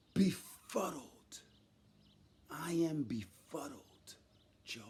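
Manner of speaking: slow, soft, almost whispered befuddled; hushed word search. Real, not acted. Finding the word for the host, slow and hushed. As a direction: breathe out befuddled as the word lands. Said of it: "What's the word?".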